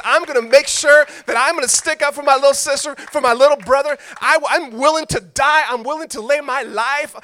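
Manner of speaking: Jesus standing up for us. Retold or imitated. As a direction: preach his promise with full conviction. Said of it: "he got upset and he says".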